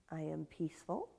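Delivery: very soft, unhurried affirmation. Shown as a demonstration. Yoga teacher giving an example intention, softly. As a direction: say it quietly, as if to yourself.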